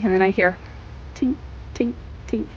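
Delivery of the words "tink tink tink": tiny, high, soft imitated tinks; small delicate sound effect, barely there; slightest tink. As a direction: imitate a faint metallic ticking, spaced out. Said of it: "the slightest tink".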